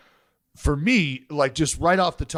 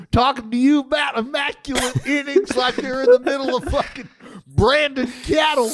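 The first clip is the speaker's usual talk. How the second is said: mock cowboy accent; 9 semitones higher than usual. Mimics the cattle-branding accent back.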